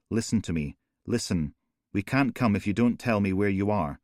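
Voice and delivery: plain reading voice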